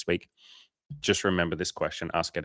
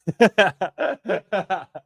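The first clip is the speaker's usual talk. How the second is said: big laugh; 12 semitones higher, 7 dB louder than usual. Real, not acted.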